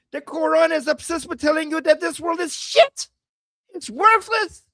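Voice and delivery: high, emphatic, building to a shout; heated ranting. Real, not acted. Heated rant on scripture's message.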